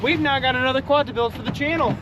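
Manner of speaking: high, thrilled, a bit shaky; buzzing after the deal; shaking with excitement. Real, not acted. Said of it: "I'm still shaking".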